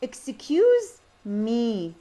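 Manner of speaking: very slow, exaggerated melody on "Excuse me"; intonation demo. Shown as a demonstration. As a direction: stretch "Excuse" up loud, let "me" fall. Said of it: "هاي down".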